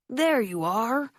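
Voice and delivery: high, slow call across the room. A narrator's reading. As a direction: call out warmly from a distance. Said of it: "a voice called out".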